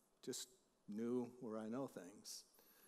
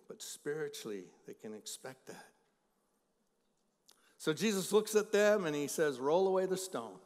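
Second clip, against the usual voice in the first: loud, ringing pulpit preaching; booming Sunday sermon voice; full-force preaching; 2 semitones higher, 7 dB louder than usual. Acted. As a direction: preach it out to the whole room. Preaching Jesus's words aloud.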